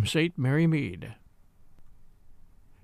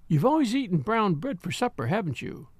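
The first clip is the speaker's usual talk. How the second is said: ordinary delivery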